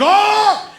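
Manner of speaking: high, loud "Oh!"; mock outburst of a refused kid; frustrated cry. Acts out son's outburst when refused.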